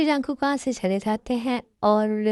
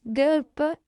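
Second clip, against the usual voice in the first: tearful short answer; acted weepy voice; crying; 4 semitones higher, slower than usual. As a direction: answer through sniffles. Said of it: "रोना बंद करो".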